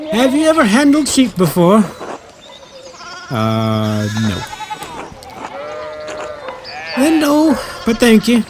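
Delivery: high-voiced village woman, brusque; high brusque woman turning down help; woman villager. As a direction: pitch up high for a gruff farm wife. Streamer voicing woman villager.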